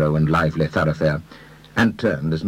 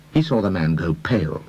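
ordinary delivery, nothing marked

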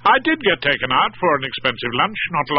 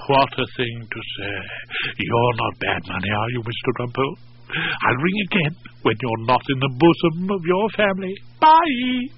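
flirtatious purr. Acted. Bambi ringing off from the married barrister, flirtatious. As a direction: tease him, then trill the farewell.

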